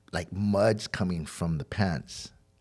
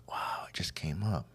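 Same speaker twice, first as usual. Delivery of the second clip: retold amazement, half-whispered; hushed wow; 3 semitones lower, 10 dB quieter than usual. Telling of finding cash in the jacket, hushed.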